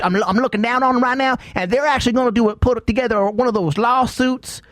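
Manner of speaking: put-on hillbilly accent, well above normal pitch. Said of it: "going back into the redneck voice".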